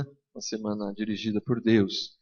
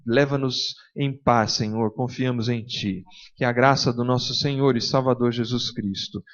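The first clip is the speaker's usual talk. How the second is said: fervent prayer voice, a bit louder and fuller; closing prayer; 3 semitones higher, 6 dB louder than usual. Acted. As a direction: pray it over the congregation with conviction. Pastor leads closing prayer. (oração fervorosa em voz mais cheia)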